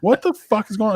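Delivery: half-mock alarm at noise before dawn. Man woken by banging outside, retelling his startled outcry. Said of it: "And I go".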